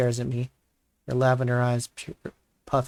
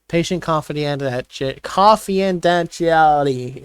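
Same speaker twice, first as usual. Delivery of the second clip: slow, drawn-out, louder irritated enunciation; sarcastic, exasperated over-pronouncing; annoyed recital; 4 semitones higher, 4 dB louder, slower than usual. Acted. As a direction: spell the term out like it's beneath you. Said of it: "an annoyed pitch to my voice when I recite that term".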